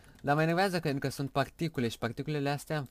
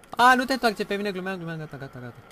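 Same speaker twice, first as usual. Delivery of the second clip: panicked plea fading; 8 semitones higher, 9 dB louder than usual. Real, not acted. Gamer pleading with an enderman not to attack, panicked then fading.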